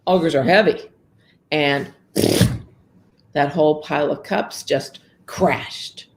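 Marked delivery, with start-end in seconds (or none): none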